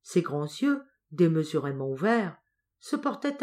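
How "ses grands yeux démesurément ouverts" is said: ordinary delivery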